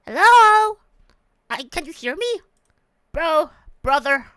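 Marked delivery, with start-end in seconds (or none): 0.1-0.8 loud shouted hello. Real, not acted.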